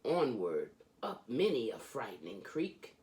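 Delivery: lively, sing-song reading of a rhyming picture book; animated children's book read-aloud; storybook reading. Acted. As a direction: read it like a bedtime story for a kid. Reads Dr. Seuss aloud.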